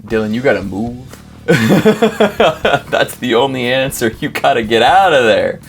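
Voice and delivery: normal talking voice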